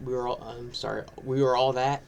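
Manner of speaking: ordinary talk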